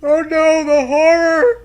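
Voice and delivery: high, loud hammed-up wail of horror; mock horror. Friend mocking the ominous narration with a hammed-up wail. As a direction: wail it like a melodrama victim.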